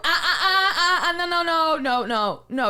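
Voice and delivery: high, stammered protest through laughter; flustered objection. Retold or imitated. Client objecting to masseuse on the phone, flustered.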